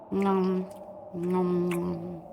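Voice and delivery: low, soft munching noms; munch softly, fading on each nom; eating noises. Acted. Tasting the cook's meal, soft low munching sounds.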